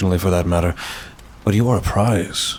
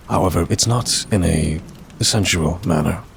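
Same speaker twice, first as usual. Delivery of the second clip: ordinary talk, nothing marked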